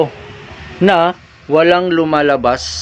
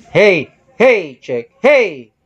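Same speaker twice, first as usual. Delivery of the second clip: shout; 3 semitones higher, much slower than usual. Shown as a demonstration. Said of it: "Sisigaw ako".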